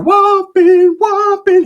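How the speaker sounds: high, loud whooping cheer; mock party whoop; excited whooping. [name] cheering in corner.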